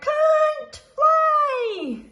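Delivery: very high, loud wail; alarmed cry. A narrator's reading. Instructor crying out as Freddy leaps, loud high wail. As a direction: stretch it out in alarm. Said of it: "cried the flying instructor".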